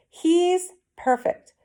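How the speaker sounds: very high, slow He's perfect; delighted cry. A narrator's reading. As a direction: savor it with glee. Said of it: "he cried".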